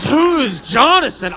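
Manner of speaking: hammed-up suspicious outburst; accusing demand. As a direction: demand to know, voicing an indignant wife. Wife's outburst, acted by husband.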